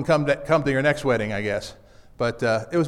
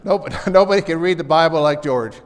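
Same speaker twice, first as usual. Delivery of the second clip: laughing; 5 dB louder than usual. Real, not acted.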